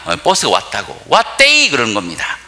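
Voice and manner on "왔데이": Daegu dialect shout. Retold or imitated. Daegu granny calling out that the bus is here, in dialect. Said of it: "대구 사투리입니다".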